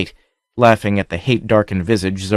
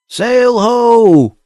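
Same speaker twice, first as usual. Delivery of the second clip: high, loud call from far off; bawled call; 14 semitones higher, 6 dB louder than usual. A narrator's reading. Lookout on the north point bawling the sighting, loud. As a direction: sing out the sighting across the beach. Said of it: "bawled the lookout".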